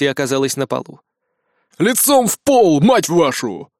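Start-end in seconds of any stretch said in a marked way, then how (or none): 1.8-3.7 angry shouted order. Acted. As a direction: bark it down from above. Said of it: "доносилось теперь откуда-то сверху".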